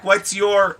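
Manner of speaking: ordinary talk, nothing marked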